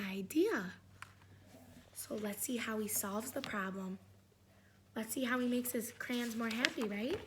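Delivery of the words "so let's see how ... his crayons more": whispered, very soft; suspense whisper. Real, not acted. Teacher building suspense.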